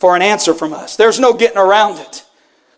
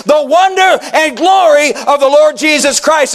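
fervent preacher, raised and driving; preaching at full force; 5 semitones higher than usual. Acted. Pastor pressing the church with a fervent call. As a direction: press the question on the congregation.

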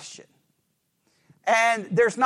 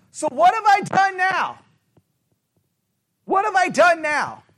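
high-pitched exasperated question; frustrated; 7 semitones higher than usual. Shown as a demonstration. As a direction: ask it fed up, pitch jumping up. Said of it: "You ask the question in a frustrated way".

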